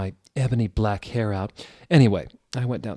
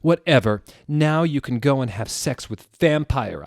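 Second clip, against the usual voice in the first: loud, snotty yell back; mock shout; 5 semitones higher, 7 dB louder than usual. Ebony yelling at Draco, loud and snotty. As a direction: fling it back dramatically. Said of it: "I shouted".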